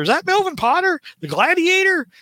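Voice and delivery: high, eager question; excited question. Retold or imitated. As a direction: ask it with sudden excitement. Retelling his own excited question.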